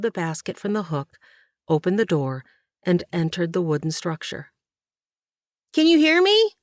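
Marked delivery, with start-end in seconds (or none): 5.7-6.5 put-on high, loud boy's question; too loud. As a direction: call out much too loudly. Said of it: "Michael asked, much too loudly".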